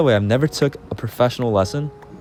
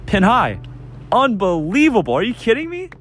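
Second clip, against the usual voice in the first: excited; 10 semitones higher than usual. Retold or imitated. Great golf shot recalled.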